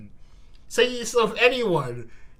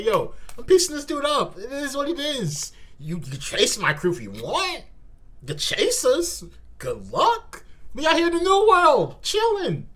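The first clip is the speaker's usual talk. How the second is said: swaggering pirate; 7 semitones higher than usual. Pretend. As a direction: shrug it off like a carefree pirate. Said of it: "Just say".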